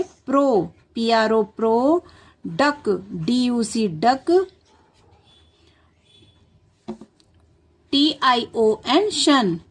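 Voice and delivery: letters spelt slowly, each chunk repeated; slow spelling out. Shown as a demonstration. Teacher dictates reproduction to children slowly. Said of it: "बच्चों से बोलेंगे क्या लिखना है".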